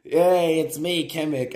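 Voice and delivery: high, loud self-introduction; stage entrance. Acted. Boy enters the play as Kamek, loud introduction. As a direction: announce your character. Actor announces his part.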